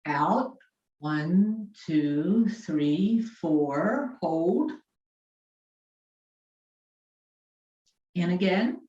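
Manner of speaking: calmly cue each breath; guided breathing cues. Acted. Leads breathing exercise.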